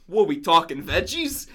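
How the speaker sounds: loud mock Italian accent. Imitating friend at sub counter, loud Italian accent. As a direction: put on a brash Italian-American accent. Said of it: "he says it in that, like, accent".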